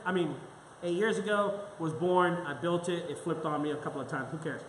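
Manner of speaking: ordinary delivery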